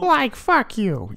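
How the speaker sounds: high, slow goofy Shaggy drawl. Acted. Teasing his friends in a high slow Shaggy voice. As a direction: stretch the insult out as a goofy Shaggy.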